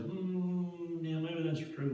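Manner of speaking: long high hum, then hesitant agreement; drawn-out, high-pitched mock pondering; doubtful hemming. Wavering camp folk weighing the spies' report, hemming doubtfully.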